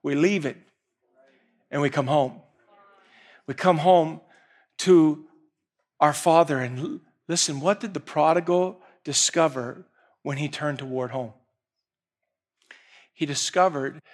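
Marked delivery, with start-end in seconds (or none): none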